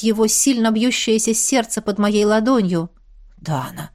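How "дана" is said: low hushed hoarse gasp; hoarse breathless gasp. A narrator's reading. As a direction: gasp her name, husky and soft. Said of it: "хрипло выдохнул Ант".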